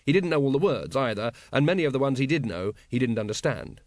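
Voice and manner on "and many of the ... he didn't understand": plain reading voice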